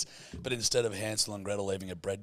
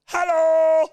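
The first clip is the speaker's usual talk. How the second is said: put-on loud, high Dutch-accented shout; 13 dB louder than usual. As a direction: bellow a hello in a Dutch accent. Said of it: "my impression of a Dutch person".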